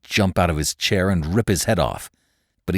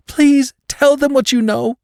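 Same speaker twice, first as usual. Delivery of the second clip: high, louder desperate begging; 6 dB louder than usual. A narrator's reading. Wounded woman begging her friend to talk, high desperate plea. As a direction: beg through the pain. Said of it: "she begged".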